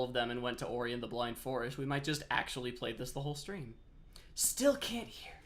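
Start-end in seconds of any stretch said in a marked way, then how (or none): 4.4-5.3 whispered, pitched up; frustrated whisper to chat. Real, not acted. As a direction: breathe it out in a whisper. Audio still broken on stream.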